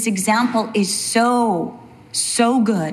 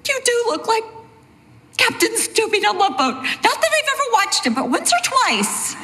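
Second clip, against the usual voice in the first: much higher teasing mom voice; high, quick, teasing wife's voice; imitating mom; 13 semitones higher than usual. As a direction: mimic mom's high playful jab. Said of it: "and my mom goes".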